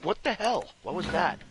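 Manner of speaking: high-pitched mock outrage; teacher catching him throwing gum, mock shriek; shocked teacher. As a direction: squeal it like a startled teacher. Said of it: "She's like".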